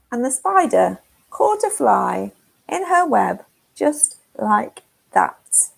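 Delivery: ordinary delivery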